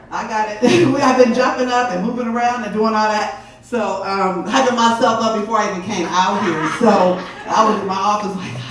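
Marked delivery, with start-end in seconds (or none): none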